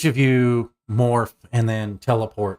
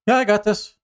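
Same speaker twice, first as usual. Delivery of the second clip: put-on high girl voice; 11 semitones higher, faster than usual. NPC girl answering boss.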